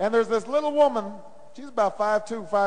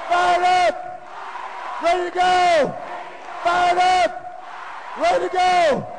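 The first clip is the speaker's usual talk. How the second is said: high, sustained crowd-rousing chant; rousing rally shout; 8 semitones higher, slower than usual. Real, not acted. Candidate asking Atlanta if they are fired up, real rally shout.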